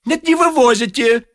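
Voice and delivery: high, desperate plea; pleading wit. A narrator's reading. The wit chasing Ostap, high plea. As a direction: beg him to stop.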